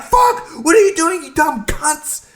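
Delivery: very high-pitched, outraged yell; exasperated outburst. Retold or imitated. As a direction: yell it high, dragging out the insult. Retold outburst at dumb users.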